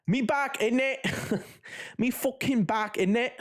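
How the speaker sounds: high, cheeky London "me back, innit"; mock English accent. Streamer hamming up a Cockney accent for chat. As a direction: put on a jokey Cockney lilt. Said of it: "every time I do an English accent".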